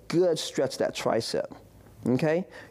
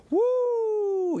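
excited whoop. Real, not acted. Helps her stretch, cheers.

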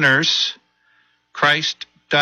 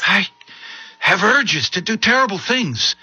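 higher, anxious and pleading; frightened confession; 8 semitones higher than usual. Acted. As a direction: confess it with fear. Son confesses urges, frightened.

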